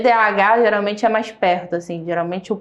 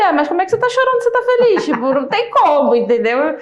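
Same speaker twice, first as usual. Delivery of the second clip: imitating a puzzled child, much higher, louder and fast; criança confusa; 9 semitones higher, 4 dB louder than usual. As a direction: faz a criança indignada, voz bem aguda, alta e rápida. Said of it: "Aí ele". (filho questiona a mãe que chora, voz aguda, alta e rápida)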